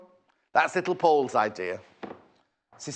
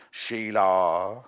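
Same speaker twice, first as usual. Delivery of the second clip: drawn-out, questioning "Sheila"; drawn-out name-calling; 5 semitones lower, much slower than usual. Pretend. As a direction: linger on the last vowel of the name, letting it rise. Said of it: "why am I saying that like that".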